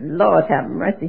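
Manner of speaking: ordinary talk, nothing marked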